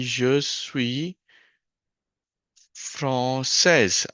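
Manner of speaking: "je suis ... française" drawn out with a pause before "française"; slow dictation. Shown as a demonstration. Teacher writing out the translation for learners, slow dictation. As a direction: stretch "je suis française" slowly, piece by piece.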